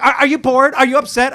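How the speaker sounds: high, loud mock concern; girlfriend voice. Imitating his girlfriend asking if he's upset, high. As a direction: squeak her worried questions. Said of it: "she was like".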